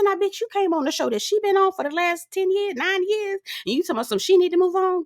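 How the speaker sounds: high, indignant clapback. Retold or imitated. Vlogger clapping back at Letitia, high and indignant. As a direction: call her out in disbelief. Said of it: "I said".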